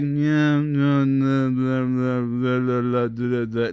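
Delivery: low monotone drone, words run together; mumbling. Acted. Showing how not to be all the time, dull mumbling. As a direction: mimic a lifeless mumbler droning on. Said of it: "mumbling through life".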